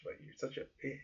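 unvoiced whisper, jokingly; whispered teasing. Real, not acted. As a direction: whisper the insult playfully. Teasing friend under breath.

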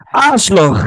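sudden yell; loud shout. Real, not acted. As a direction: blurt it out full volume. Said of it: "Ich wollte einmal so richtig laut".

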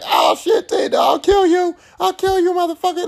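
put-on high, frantic yelling as the panicked boyfriend; panicked yelling man. Rod panics in the room.

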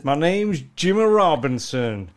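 slow, drawled American cowboy voice; lightly done cowboy accent, slow and proud; mock Texan. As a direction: play the self-important Texan. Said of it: "He said".

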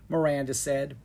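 ordinary delivery, nothing marked

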